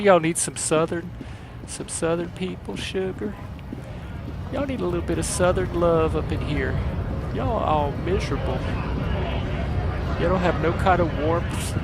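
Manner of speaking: put-on country Southern accent. Streamer showing off a country voice, high Southern accent. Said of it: "I could do the country".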